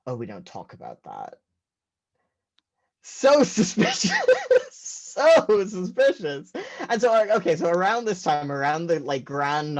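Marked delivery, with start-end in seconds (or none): 0.1-1.4 curt mom. Retold or imitated. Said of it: "my mom just goes". 3.0-6.5 high, loud, drawn-out suspicious; mock suspicious. Comedian mocking family secrecy, high and loud. Comic aside repeated.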